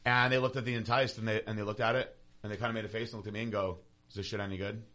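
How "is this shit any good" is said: quieter, low-key retold question; offhand question. Guy at the booth asking about the product, low-key. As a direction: retell it as a casual, sceptical ask. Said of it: "Just like that".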